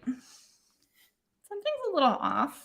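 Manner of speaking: slow, soft, puzzled to herself; quiet slow suspicion; puzzled murmur. Retold or imitated. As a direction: mutter it slowly, suspicious of the toast. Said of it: "and I'm like".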